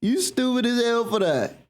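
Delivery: high, slow mocking jeer; mocking friend. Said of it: "me talking to my people, you be like".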